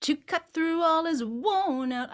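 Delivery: worn pressed a little; lean on worn. Shown as a demonstration. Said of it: "I would lean for sure on the word worn".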